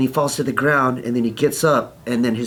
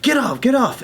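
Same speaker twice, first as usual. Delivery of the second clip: high-pitched mock anger; retold angry order; 12 semitones higher than usual. Aggressor telling a bystander off, mock angry. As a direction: act out an angry brush-off.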